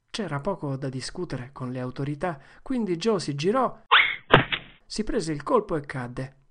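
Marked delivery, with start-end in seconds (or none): none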